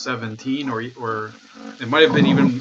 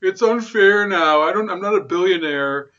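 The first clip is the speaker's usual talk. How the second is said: whiny mock Kamala Harris; 7 semitones higher than usual. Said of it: "she was like".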